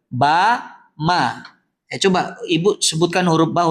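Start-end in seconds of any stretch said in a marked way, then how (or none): 0.1-1.4 "Ba, ma." said slowly with both lips pressed shut; tajwid demo. Shown as a demonstration. Teacher showing the ladies "Ba, ma." from both lips. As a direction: demonstrate "Ba, ma." as lip sounds, both lips closing. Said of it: "Keluar dari dua bibir".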